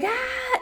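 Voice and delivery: small panicked shriek, held back; startled squeal. Acted. Caught by a cop, squealing in mock fright. Reacts to cop catching him.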